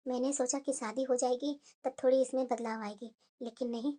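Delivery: mother sighing over her daughter, hushed; muttering to herself. Acted. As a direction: grumble quietly so nobody hears. Mother worries aloud to herself.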